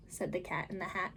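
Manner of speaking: ordinary delivery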